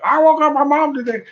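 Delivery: put-on high, proud little-girl quote; teasing imitation of Michelle, pitched high; mock girly voice. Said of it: "She's like".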